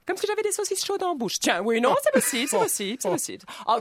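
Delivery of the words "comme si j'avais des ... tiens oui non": ordinary talk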